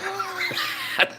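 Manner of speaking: high cackling laugh; amused laugh. Real, not acted. Fan laughing at a crazy coach, amused. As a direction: burst out laughing.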